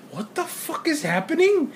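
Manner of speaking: baffled mock panic; startled question; alarmed disbelief. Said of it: "I'm just like".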